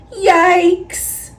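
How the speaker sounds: a high, mock-startled yelp; hammed-up fright for young listeners; startled yikes. A narrator's reading. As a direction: jump at the sight of the whale. Sees a whale's shadow.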